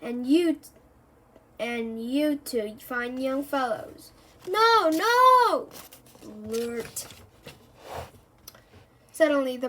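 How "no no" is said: much higher, loud cry; children begging the professor to stop; desperate cry. Acted. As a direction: shout to stop him. Kids protest losing the machine.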